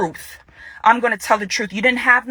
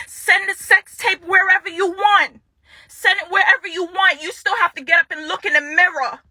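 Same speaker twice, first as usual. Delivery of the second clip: real defiance, pitched high; defiant; 6 semitones higher than usual. Real, not acted. Woman daring her ex who released the tape, defiant. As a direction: throw it out as a high, angry dare.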